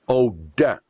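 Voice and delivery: ordinary talk, nothing marked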